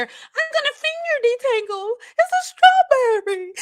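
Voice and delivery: high, silly put-on voice of a naive follower; mock naive follower. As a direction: lampoon the finger-detangling crowd. Mocking natural-hair followers.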